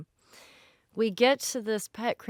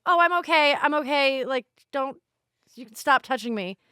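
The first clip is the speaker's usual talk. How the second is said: flustered protest; 6 semitones higher, 5 dB louder than usual. Retold or imitated. Hugged by stranger, flustered.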